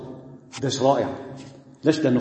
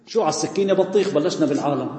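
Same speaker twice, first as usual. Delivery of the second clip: ordinary delivery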